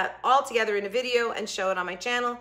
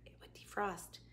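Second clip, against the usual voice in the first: whispered aside; 17 dB quieter than usual. Real, not acted. Aside about frozen food.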